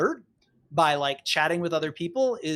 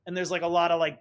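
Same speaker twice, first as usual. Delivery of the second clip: normal talking voice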